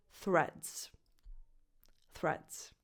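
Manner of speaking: "Threads" starting as in "think", then "reds"; voiceless TH. Shown as a demonstration. Teacher modelling for learners, "Threads" with voiceless TH. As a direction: say "Threads" with tongue-tip TH, vowel of red. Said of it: "start with a voiceless T H".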